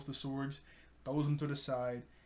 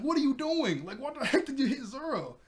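high, louder mock outrage; indignant scolding; 10 semitones higher, 4 dB louder than usual. Nami to Usopp after he hits Zoro, indignant scolding.

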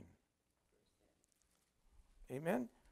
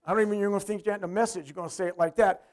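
ordinary talk, nothing marked

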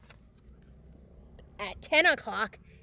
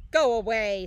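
loud grumpy snap; gruff, loud go-away; grouchy ladybug; 7 dB louder than usual. A narrator's reading. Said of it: "said the grouchy ladybug".

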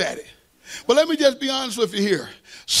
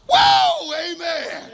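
excited whoop; 7 semitones higher, much slower than usual. Acted. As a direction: burst out with a preacher's holler. Excited outburst at chance to preach.

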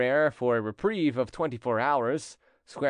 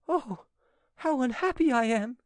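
high, wailing female voice; anguished exclamation; 12 semitones higher than usual. A narrator's reading. Angelique laments as he leaves, anguished. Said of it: "exclaimed Angelique".

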